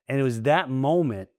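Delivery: usual speaking voice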